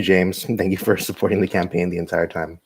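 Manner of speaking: normal talking voice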